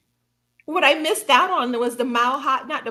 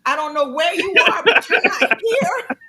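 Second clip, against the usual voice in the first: telling off God; 8 semitones higher, 4 dB louder than usual. Retold or imitated. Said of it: "I told him".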